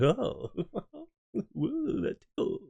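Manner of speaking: a relaxed tourist who is barely fazed; mock chill giggle. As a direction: laugh it off as if the monkey tickles. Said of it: "just be like".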